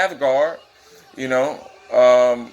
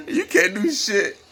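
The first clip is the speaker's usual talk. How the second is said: high and slow, through laughter; laughing; 13 semitones higher, slower than usual. Real, not acted. Viewer laughing at the beating, words squeezed high.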